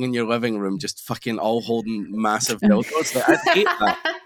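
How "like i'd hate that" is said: laughing. Real, not acted. Laughing while imagining horror.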